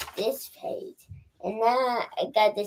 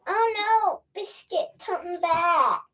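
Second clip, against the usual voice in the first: put-on reading to a toddler; 9 semitones higher, slower than usual. Child reads the Biscuit book aloud.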